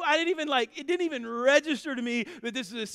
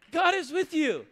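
lifted high and louder, proclaimed; ringing declaration; 6 semitones higher, 5 dB louder than usual. Shown as a demonstration. Pastor proclaiming God's presence to the church, loud. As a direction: declare it to the back row.

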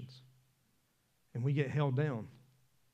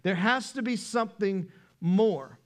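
loud, high, driving sermon voice; full-force preaching; 5 semitones higher, 6 dB louder than usual. Acted. Pastor preaching to the congregation, loud and driving.

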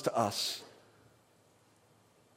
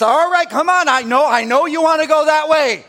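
high, loud, laughing urging along; God urging a reluctant child, loud and laughing; loud laughing coaxing; 9 semitones higher, 7 dB louder than usual. Retold or imitated. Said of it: "Sometimes it's".

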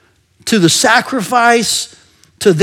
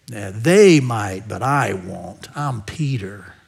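low, slow, self-assured boast; Peter; 10 semitones lower, 6 dB quieter, slower than usual. Acted. Peter setting himself above the other disciples, low smug boast. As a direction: drop low and stretch it out, sure of yourself. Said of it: "You can just hear him saying".

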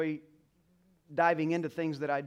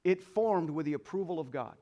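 louder, higher preacher's cry; preaching at full force; 4 semitones higher, 7 dB louder than usual. Acted. Pastor urging the mothers in the congregation, preaching loudly. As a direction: lift the church with rising conviction.

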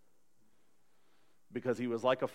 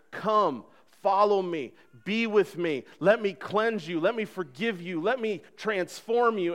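raised, louder, slowing, inviting God voice; God's invitation; 6 semitones higher, 5 dB louder, slower than usual. Acted. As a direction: call them in like God opening his arms.

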